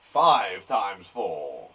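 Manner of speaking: mock-solemn deep slow delivery; put-on deep voice teasing the class; silly deep voice. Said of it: "Sorry, I don't know what's going on today".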